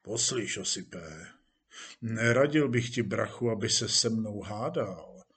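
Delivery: quiet, low warning; stern warning. Acted. Police chief warns actor not to argue, sternly. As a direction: warn a subordinate with restrained menace. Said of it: "Hejtman se zamračil".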